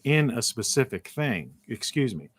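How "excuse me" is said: croaky early morning voice; rough, just-woke-up throat. Real, not acted. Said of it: "early in the morning voice again".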